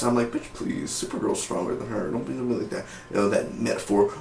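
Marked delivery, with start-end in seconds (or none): 0.3-2.9 whispered retort. Pretend. His own scoffing reply to mom.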